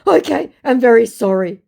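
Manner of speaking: high, loud hammed-up sorry; mock sad apology. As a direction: fake a pitiful apology. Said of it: "sad face".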